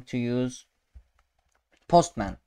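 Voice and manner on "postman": usual speaking voice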